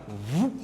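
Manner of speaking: short, low swooping "whoop"; imitated whoop. Shown as a demonstration. Teacher showing pressure falling, quick low whoop sound. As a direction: mimic air suddenly being sucked away.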